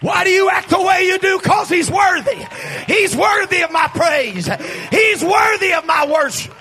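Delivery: high, shouted sermon climax; roaring, exultant preacher's delivery; full-force preaching. Real, not acted. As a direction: shout praise to rouse the congregation.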